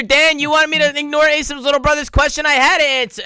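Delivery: high, loud mock indignation; mock outrage. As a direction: play up the injured protest.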